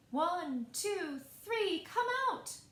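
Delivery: counting out loud and high, rising to a shout; crowd yelling. Acted. Crowd counting down to the groundhog, shouting. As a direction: call the count to a groundhog, building up. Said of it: "the crowd yelled".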